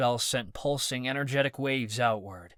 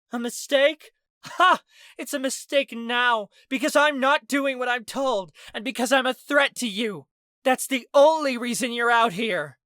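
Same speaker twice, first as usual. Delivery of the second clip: high, loud scornful shout; shouting back; 12 semitones higher, 6 dB louder than usual. A narrator's reading. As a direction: fire it back with a mocking laugh. Shouting argument during the fight.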